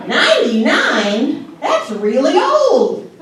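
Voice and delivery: slow, high, laughing disbelief; mock horrified old mom; shocked mother. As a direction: act shocked at the age. Mother's reaction voiced.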